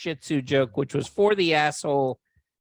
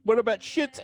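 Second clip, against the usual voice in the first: mock pitching the joke; 9 semitones higher than usual. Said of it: "I'm like".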